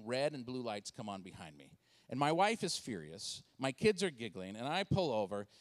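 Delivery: ordinary delivery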